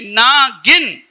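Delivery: word stretched into two slow parts; slow clarifying. Acted. Husband clarifying to offended wife, slow and deliberate. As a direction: draw out नागिन very slowly, as ना, गिन.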